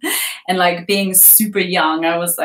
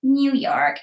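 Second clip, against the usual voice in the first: higher-pitched stab at a New York accent; attempted New York accent; 7 semitones higher than usual. Shown as a demonstration. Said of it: "I can't really do that accent".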